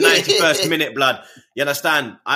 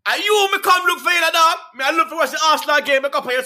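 much higher, fast, heavy Jamaican patois; strong Jamaican accent; 10 semitones higher than usual. Retold or imitated. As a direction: answer like a hyped Jamaican man. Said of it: "man's accent was stronger than mine".

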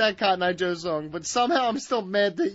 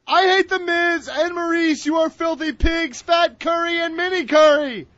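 loud dramatic read; 7 semitones higher, 7 dB louder than usual. Acted. Reading comment in put-on voice.

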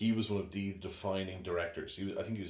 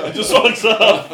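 high, loud words through laughter; laughing punchline; 21 dB louder, slower than usual. Real, not acted. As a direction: burst out laughing while finishing the story.

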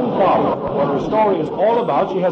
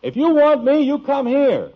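shouting back; 9 semitones higher, 4 dB louder than usual. Acted. Father shouts back at son.

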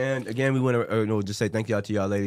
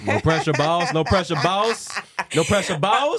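laughing teasing; 5 semitones higher, 5 dB louder, slower than usual. Real, not acted.

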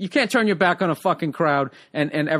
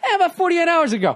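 much higher mock confession; mocking imitation; 13 semitones higher, 3 dB louder than usual. Co-host mimicking the host's lapse, high mocking voice. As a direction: mimic him sheepishly admitting it, pitched way up. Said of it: "He's doing this whole thing".

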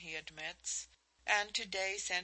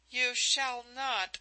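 higher, louder, slow forceful declaration; fierce vow; 6 semitones higher, 7 dB louder, slower than usual. Acted. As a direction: plant each word like an oath. Vowing to stop her marriage.

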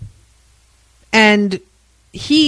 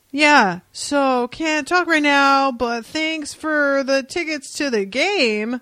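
higher, slow brush-off voice of Dude; Dude's voice; 6 semitones higher, slower than usual. Retold or imitated. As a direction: put her off in a higher, slow voice. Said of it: "he's all".

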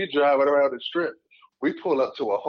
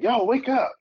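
raised, higher wake-up call; rousing someone; 10 semitones higher than usual. Retold or imitated. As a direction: imitate urgently trying to wake someone. Retold attempt to rouse passed-out woman.

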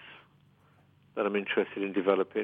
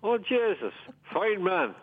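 put-on County Mayo accent; 11 semitones higher, slower than usual.

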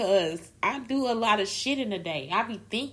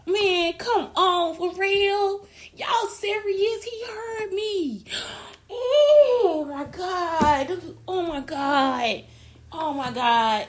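much higher, loud, slow, near-crying swoon; overwhelmed swooning; 10 semitones higher, 9 dB louder, much slower than usual. Real, not acted. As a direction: wail it like you can't take it. Overwhelmed swooning over him.

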